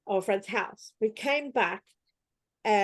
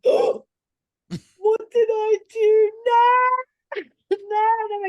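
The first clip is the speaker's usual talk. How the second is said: high, loud mock wail of panic; 12 semitones higher, 8 dB louder, much slower than usual. Retelling her panic at seeing her name, high mock wail. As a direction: squeal it like a caught kid. Panicked retold reaction to Santa.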